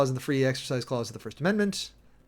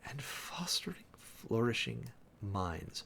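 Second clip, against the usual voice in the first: whisper; 8 dB quieter, slower than usual. Real, not acted. Whispered reading of odd phrase.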